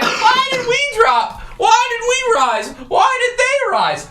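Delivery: a very high, slow, whiny imitation of the fans; sing-song mock of confused viewers; mocking complainers. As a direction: parody the fans asking over and over. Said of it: "Everyone's like".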